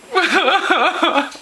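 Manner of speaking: high, wobbling fake wail; hammed-up childish bawling; mock sobbing. Kid in front of dad, mock sobbing. Kid's acted bawling at dinner.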